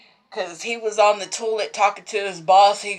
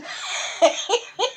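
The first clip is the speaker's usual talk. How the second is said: airless high laugh; laughing at the husband; breathy high giggle; 11 semitones higher, 5 dB quieter than usual. Real, not acted. Laughing mid-quote.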